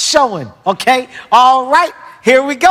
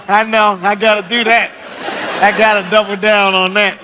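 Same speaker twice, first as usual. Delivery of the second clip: ordinary talk, nothing marked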